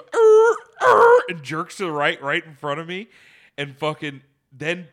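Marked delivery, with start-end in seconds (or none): 0.0-1.2 high loud imitated tire screech; mock brake screech. Retelling the car's skid, mock screech. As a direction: squeal like skidding brakes. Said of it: "does the".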